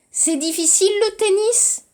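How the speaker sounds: tennis lifted high at the end as a question; rising question. Shown as a demonstration. Teacher showing the class the question intonation. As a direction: go up on tennis, ask it.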